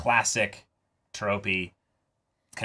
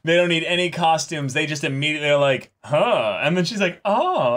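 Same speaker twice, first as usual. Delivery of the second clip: excited teasing; 7 semitones higher, 5 dB louder than usual. Retold or imitated. Joking about roleplay, raised voice.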